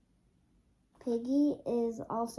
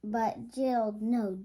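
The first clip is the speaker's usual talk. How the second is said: ordinary delivery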